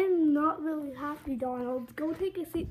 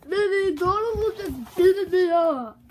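loud complaint; 4 semitones higher, 8 dB louder than usual. Acted. Upset kid complaining loudly.

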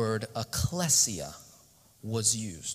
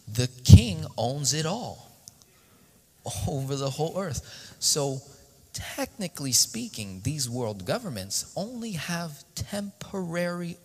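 rising preaching; 4 semitones higher, 4 dB louder than usual. Acted.

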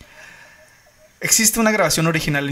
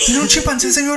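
mock disbelief; 10 semitones higher, 7 dB louder than usual. Mock operator asking in disbelief.

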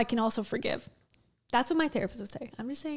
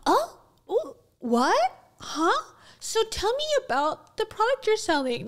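much higher, fake-fascinated podcast host; mocking podcaster; 11 semitones higher, 3 dB louder than usual. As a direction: ham up a shallow interviewer's gasps. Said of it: "They go".

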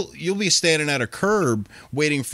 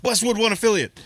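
radio announcer voice; 11 semitones higher, faster than usual. Retold or imitated. Waking from sweat, radio ID.

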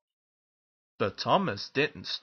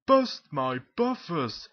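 slow, emphatic, higher exclamation; startled exclamation; 4 semitones higher, 5 dB louder than usual. Acted. Henry exclaiming as Thomas races past, startled. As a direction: exclaim it in storybook astonishment.

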